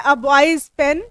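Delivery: very slow, word by word, as if dictating; teacher dictating examples slowly; slow dictation. Shown as a demonstration. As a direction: spell out each example slowly for the class. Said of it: "यहाँ पर लिखा है".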